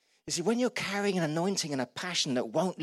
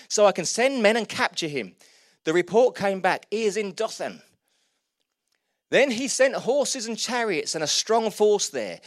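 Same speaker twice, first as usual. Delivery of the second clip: reads the passage with raised pitch and energy; scripture reading; 3 semitones higher, 3 dB louder than usual. Acted. Preacher reading Second Kings to the church, storytelling. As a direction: tell the Bible story aloud to the room.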